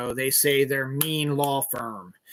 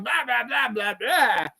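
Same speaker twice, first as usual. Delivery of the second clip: mock ranting; 8 semitones higher than usual. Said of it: "He's all like".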